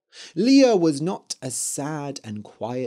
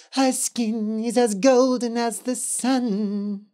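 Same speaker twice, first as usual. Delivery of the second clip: higher eerie witch voice; 8 semitones higher than usual. A narrator's reading. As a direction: croon it like a ghost witch. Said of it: "said the Yellow Witch".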